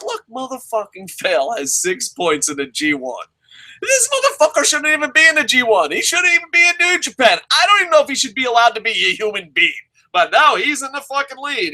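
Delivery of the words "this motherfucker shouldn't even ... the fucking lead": high, fast, disbelieving outburst; incredulous rant. Real, not acted. Fan ranting about the wrestler, high, fast and incredulous. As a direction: rant in shock, much higher and faster.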